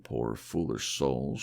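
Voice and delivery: normal talking voice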